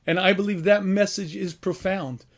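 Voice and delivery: ordinary delivery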